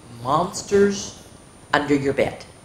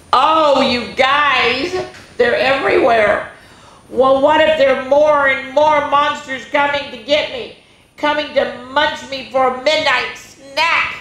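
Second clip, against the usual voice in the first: put-on higher, louder child voice; scared boy; 10 semitones higher, 4 dB louder than usual. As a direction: monsters everywhere, growing alarm. Frightened boy in story.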